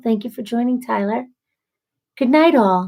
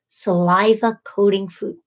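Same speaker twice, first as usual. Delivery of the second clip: usual speaking voice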